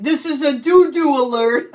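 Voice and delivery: loud echo mic announcement; toy echo microphone. Retold or imitated. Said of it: "She's like".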